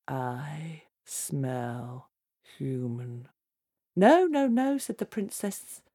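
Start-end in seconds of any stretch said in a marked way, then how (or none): 0.1-3.3 low, hushed giant voice; menacing giant. Acted. Giant sniffs in the princess's room, low whispery menace. 4.0-4.8 high, hasty denial; protesting princess. Acted. Princess hides Jack from the sniffing giant, high protesting voice.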